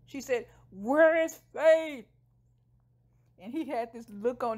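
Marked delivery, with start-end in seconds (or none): 0.7-2.1 loud, high, drawn-out shout; exasperated woman in labor shouting; acted demanding yell. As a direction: yell it slowly, stretching each word. Labor, she yells the question again.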